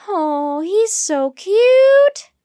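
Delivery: high, loud, drawn-out gushing; squealy, smitten cooing; adoring coo. A narrator's reading. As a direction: coo over an adorable little animal. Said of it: "cooed Annie".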